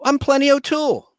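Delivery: put-on high coy Bond girl line. Host redoing the Bond girl's line on request. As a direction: lilt the name up high. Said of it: "Do it again".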